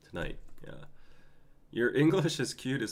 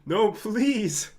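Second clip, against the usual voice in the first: loud pleading; 9 semitones higher, 12 dB louder, much slower than usual. Real, not acted. Begging chat to stop.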